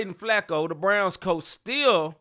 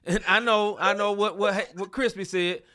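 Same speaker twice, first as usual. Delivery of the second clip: ordinary talk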